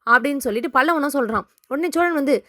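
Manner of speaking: ordinary delivery, nothing marked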